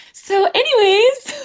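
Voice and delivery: high, slow, airy "so anyways"; hammed-up ditzy deflection; clueless brush-off. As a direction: play dumb and change the subject, drawn out. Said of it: "I'm always like".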